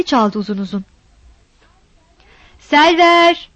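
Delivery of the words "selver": a drawn-out call at the door, pitched high. Acted. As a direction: call her name long and loud through the closed door. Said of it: "diye bağırdı".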